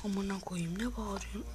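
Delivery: whispered curse; whispered annoyance at the noise. Real, not acted. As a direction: hiss the swear under the breath. Swears when something makes noise.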